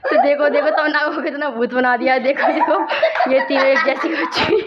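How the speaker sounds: can't stop laughing while showing the painted faces. Real, not acted. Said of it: "हमें तो हंसी आ रही बहुत तेज". (हंसते हुए बोलना)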